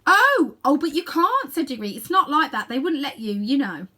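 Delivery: anxious protest. A narrator's reading.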